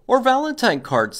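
high, quick little bunny. Acted. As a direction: pipe it up like an eager bunny. Bunny voiced in story.

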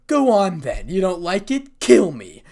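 loud, goading dare; defiant challenge. A narrator's reading. Issei daring Sir Zex to kill him, loud and defiant.

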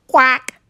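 high, loud quack; one loud high duck call; duck's quack. A narrator's reading. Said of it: "said the duck".

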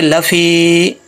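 "लफ़ी" with a long, held final ee; lengthened vowel. Shown as a demonstration. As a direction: draw out the end of "लफ़ी". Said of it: "इसको लंबा करेंगे". ("लफ़ी" में फ़ी को लंबा खींचें)

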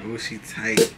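usual speaking voice

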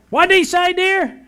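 high, loud blurt from a hard-of-hearing wife. Acted. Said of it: "the man's wife blurted out".

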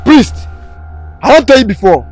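high, loud furious rebuke; angry shout. Acted. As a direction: tear into the priest. Pastor confronts the priest.

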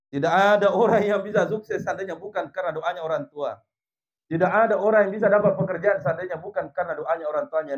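forceful high delivery, easing slightly at the end; emphatic preaching. Real, not acted. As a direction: hammer the point home to the whole congregation. Preacher drives main point home.

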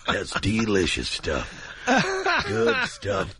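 very low, slow savouring voice; satisfied eater. Acted. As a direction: savour it, deep and drawn out. Acts eater at swingers club.

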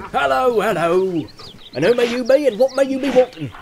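high, loud farmer's challenge; suspicious farmer hailing intruders; growling farmer challenge. Acted. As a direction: call out gruffly to strangers at the gate. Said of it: "changing from a growl to a grin".

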